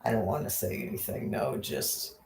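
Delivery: soft whisper, holding back; hushed whisper. Real, not acted. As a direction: whisper it, trying not to laugh.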